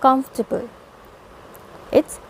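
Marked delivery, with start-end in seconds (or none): 0.0-0.7 कंफर्टेबल in three syllables, KUMF-tuh-bul; कंफर्टेबल with the middle vowel dropped; correct pronunciation. Shown as a demonstration. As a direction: squeeze कंफर्टेबल into three parts, stress on the first. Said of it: "exactly जो correct pronunciation है word का".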